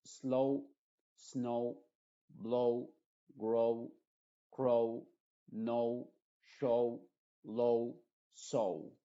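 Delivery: slow, snow, blow with gliding "ou", w silent; teacher drilling a word list; English long o. Shown as a demonstration. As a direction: stretch each o into u. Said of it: "se pronuncia como una O original".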